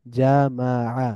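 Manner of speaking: জামাআ slowly as ja, ma, 'a; syllable by syllable. Shown as a demonstration. Teacher breaking down the word, জামাআ syllable by syllable. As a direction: split জামাআ into three drawn-out syllables. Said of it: "একটু মিলিয়ে মিলিয়ে পড়ব".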